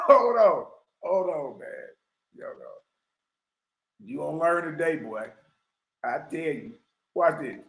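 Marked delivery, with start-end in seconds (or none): none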